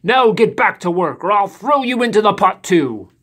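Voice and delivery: loud booming giant order; menacing giant. A narrator's reading. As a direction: bark the order. Giant commands.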